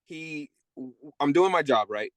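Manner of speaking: ordinary delivery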